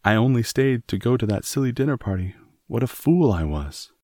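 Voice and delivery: a mild distressed cry; anguished self-reproach. A narrator's reading. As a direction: scold herself, close to tears. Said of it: "she cried".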